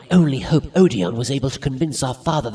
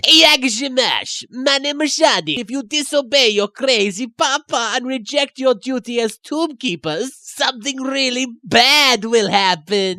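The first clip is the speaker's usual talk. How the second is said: high eerie spirit voice; spooky ghost; 7 semitones higher than usual. Acted.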